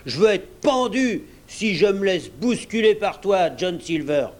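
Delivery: high, indignant challenge; heated rebel pirate; mutinous defiance. Acted. Pirate rebels against Silver.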